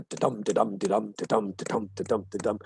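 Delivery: da-DUM, low even pulse with DUM stressed; iambic heartbeat rhythm. Shown as a demonstration. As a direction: beat out "da-dum" like a heartbeat, weight on dum. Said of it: "That's the rhythm of".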